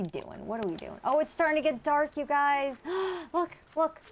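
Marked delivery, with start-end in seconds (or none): none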